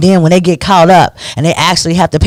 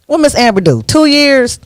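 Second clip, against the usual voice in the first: usual speaking voice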